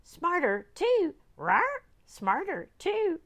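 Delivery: high, loud parrot squawk. Acted. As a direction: screech it like a parrot.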